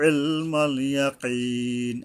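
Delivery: ইলমাল begun from the throat, ইয়াকিন drawn out; careful ayn. Shown as a demonstration. Teacher models ayn, ইলমাল ইয়াকিন slowly recited. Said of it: "আইন উচ্চারণে সাবধান হতে হবে".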